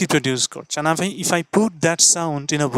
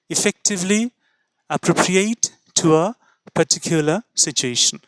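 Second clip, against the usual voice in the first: slow definition repeat; much slower than usual. Shown as a demonstration.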